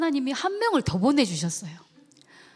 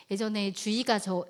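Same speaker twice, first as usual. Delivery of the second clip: ordinary delivery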